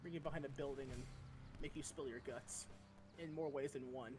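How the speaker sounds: soft, low, menacing; menacing low threat. Pretend. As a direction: quietly threaten them.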